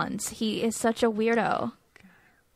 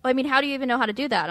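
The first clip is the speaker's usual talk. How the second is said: fast incredulous; 3 semitones higher, 3 dB louder, much faster than usual. Retold or imitated. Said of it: "he's like".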